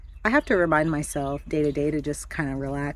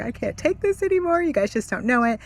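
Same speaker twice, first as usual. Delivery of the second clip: retold crying; 7 semitones higher than usual. Mother breaking down in the car, high tearful retold wail. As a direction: wail it like you're at your wit's end. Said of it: "I'm boo-hooing, crying".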